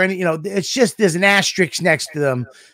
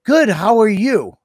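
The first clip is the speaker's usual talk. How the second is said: higher, drawn-out friendly greeting; pleasant reply; 5 semitones higher than usual. Shown as a demonstration. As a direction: show how nicely it should have gone. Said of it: "I should have said".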